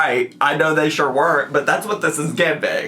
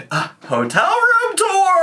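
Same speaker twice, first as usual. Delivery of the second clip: mocking vlogger voice. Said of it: "they're like".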